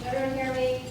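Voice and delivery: usual speaking voice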